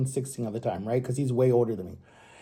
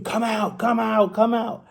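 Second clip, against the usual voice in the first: higher-pitched imitation of little brother screaming; mock scream; 5 semitones higher, slower than usual. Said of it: "like, screaming".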